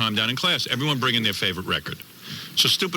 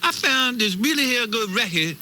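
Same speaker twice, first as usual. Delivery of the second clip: higher, slow put-on street voice; mock kid slang, drawn out; hammed-up urban slang pitch; 9 semitones higher, slower than usual. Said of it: "and they talk like this".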